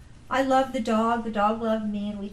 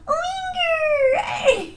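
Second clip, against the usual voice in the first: high laughing squeal; dog talk cry; 4 dB louder than usual. Retold or imitated. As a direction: fling arms up and squeal it. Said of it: "kinda go".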